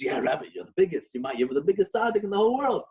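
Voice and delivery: high, eager flattery; flattering husband. Retold or imitated. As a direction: gush over the Rebbe. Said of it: "He goes".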